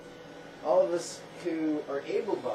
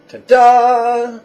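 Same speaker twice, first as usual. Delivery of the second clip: loud, high triumphant reveal; belted-out stage reveal; showy ta-da; 9 semitones higher, 16 dB louder than usual. Acted. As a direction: sing out a big proud flourish. Imagined showing off one's body.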